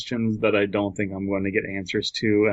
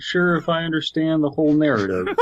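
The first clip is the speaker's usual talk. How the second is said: advisor quote; 6 semitones higher than usual. Retold or imitated. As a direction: mimic the puzzled advisor, pitched up. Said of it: "he finally said".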